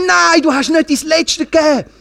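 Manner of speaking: high-pitched, acted cry of reproach; forceful rebuke. God tells the half-hearted person they held back, in high indignant reproach. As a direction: exclaim it as an indignant correction.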